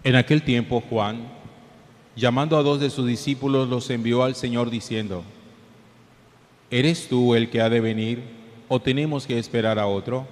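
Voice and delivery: solemn gospel reading, echoing; cathedral echo. Real, not acted.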